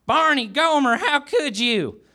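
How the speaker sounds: high mock shriek, stretched at the end; Goober shrieking. A narrator's reading. Goober walking in on Barney and Gomer, high mock shriek. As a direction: cry it out high and scandalized. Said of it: "He shrieked".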